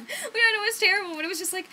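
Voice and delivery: high, loud laugh through the words; giggling as she admits it. Real, not acted. To viewers about the full-price book, laughing loudly.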